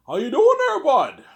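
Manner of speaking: high, loud mock Canadian; exaggerated Canadian accent. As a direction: ham up a hardcore Canadian greeting. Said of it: "you have a Canadian accent".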